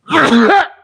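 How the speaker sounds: sudden explosive high sneeze; real loud sneeze. Real, not acted. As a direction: let a big sneeze burst out. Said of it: "during the sneeze".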